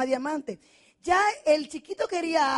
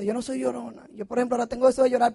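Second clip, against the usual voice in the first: ordinary talk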